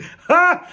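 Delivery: a high, loud burst of laughter; sudden high laugh. Real, not acted. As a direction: crack up at your own joke.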